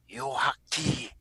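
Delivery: high, slow, thin chant; faint old woman. Acted. Game master repeating what the old lady said, slow high croon. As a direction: repeat the strange word like an eerie old lady. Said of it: "that she heard going".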